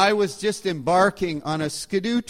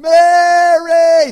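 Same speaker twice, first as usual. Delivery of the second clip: long, high shouted call; re-enacted search yell, drawn out at full volume; 12 semitones higher, 17 dB louder than usual. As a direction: holler the name far across the wilderness. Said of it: "So I start yelling".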